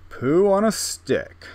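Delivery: loud, slow, grandly projected silly phrase; put-on deep voice. Shown as a demonstration. Gamer proving anything sounds better this way, mock deep voice. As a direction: boom it out like something impressive. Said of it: "Everything sounds better when you talk in a deep voice".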